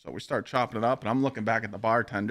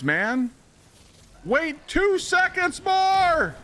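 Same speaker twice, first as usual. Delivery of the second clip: real frustrated gamer shout, drawn out; gaming rage yelling; much slower than usual. Real, not acted. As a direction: yell at the screen as the round falls apart. Yelling at teammates mid-game.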